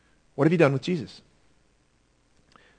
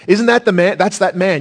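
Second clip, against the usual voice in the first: raised, louder and higher pleading; wife's urgent plea; 6 semitones higher, 7 dB louder than usual. Retold or imitated. Wife begging Pilate to spare Jesus, urgent raised plea. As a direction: beg him as his alarmed wife. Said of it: "and she says".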